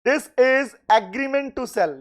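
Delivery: this is agreement to sell, said slowly with this stressed and falling; slow, deliberate model answer. Shown as a demonstration. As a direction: spell out this is agreement to sell word by word as the answer to write. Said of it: "इसको बोलना है".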